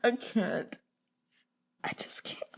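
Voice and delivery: drained breathy near-whisper; exhausted whisper. Real, not acted. Reader overwhelmed by the series, worn-out whisper. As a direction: let it fade out, spent. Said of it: "I am exhausted".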